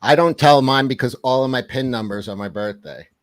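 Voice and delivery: usual speaking voice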